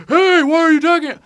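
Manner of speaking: very high, loud heckler's holler; mock yelling. Dude with a pitchfork jumping in, high mock yell.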